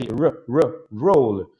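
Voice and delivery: repeated R then "roll" with the English R; English R drilled. Shown as a demonstration. Teacher drilling the R sound in "roll". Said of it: "com R".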